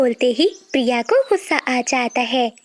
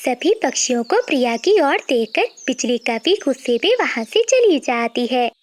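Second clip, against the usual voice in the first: ordinary delivery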